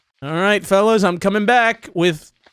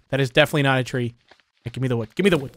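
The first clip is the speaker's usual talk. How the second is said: ordinary talk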